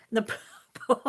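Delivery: ordinary delivery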